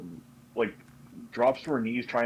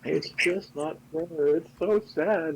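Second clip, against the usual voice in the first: high, slow whimper; pouty crying; 7 semitones higher, slower than usual. Acted. Daishin lamenting the dying plane, pouty high whimper. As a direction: pout and whine through tears. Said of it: "all, like, pouty".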